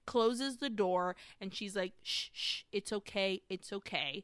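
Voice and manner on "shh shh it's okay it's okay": retold soothing hush. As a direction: hush and calm a frightened child, lightly acted. Old Dulce soothing the boy, retold.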